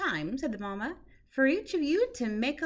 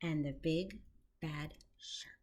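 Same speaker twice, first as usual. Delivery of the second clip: breathy whisper of the villain's name; spooky whisper; 6 semitones lower, 11 dB quieter than usual. Acted. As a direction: hush it like a scary secret. Spooky title reveal.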